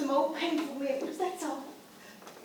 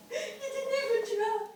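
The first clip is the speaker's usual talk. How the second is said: crying; 7 semitones higher, 4 dB quieter than usual. Acted. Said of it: "Please don't cry".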